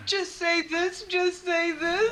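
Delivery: high, drawn-out teasing "just say this"; sing-song mock demand; mocking copycats. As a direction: parrot the taunt like the people who mock the accent. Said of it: "They're like".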